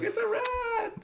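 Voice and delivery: very high, stretched-out squeal; hammed-up squeal of horror; mock panic. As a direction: imitate a panicking crowd without shouting. Panicking crowd acted out.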